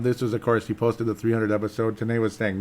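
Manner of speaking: ordinary talk, nothing marked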